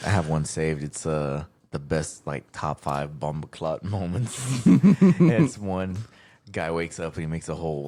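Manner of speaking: usual speaking voice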